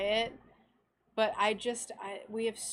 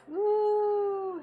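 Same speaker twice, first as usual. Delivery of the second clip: excited; 10 semitones higher, 6 dB louder than usual. Real, not acted. Cheering a viewer's syrup.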